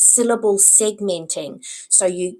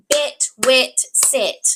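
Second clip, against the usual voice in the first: "Bet, wet, set" loud, each word set apart; rhyming word list; 5 semitones higher, 7 dB louder, slower than usual. Shown as a demonstration. Teacher shows parents a rhyme game, words punched out separately. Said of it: "okay, rhyming".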